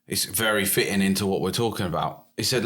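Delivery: ordinary talk, nothing marked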